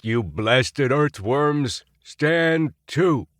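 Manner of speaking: barking cadet sergeant voice; stern drill instructor. A narrator's reading. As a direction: bark orders at the recruits. Upperclassman hazing cadets.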